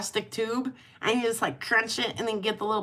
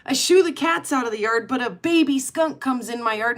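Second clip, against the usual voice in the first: ordinary talk, nothing marked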